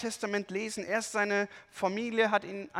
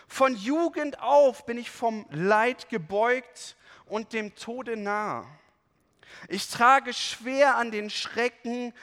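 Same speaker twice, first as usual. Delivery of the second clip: loud, grave reading of a lament psalm; solemn scripture reading; 3 semitones higher, 5 dB louder than usual. Acted. Preacher reads the psalm to the congregation, solemn and loud. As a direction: read it out like a heavy cry to God.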